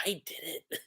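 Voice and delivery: faint spent whisper. Retold or imitated. Kobe whispering to Helmeppo after his big blow. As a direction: breathe it out, drained.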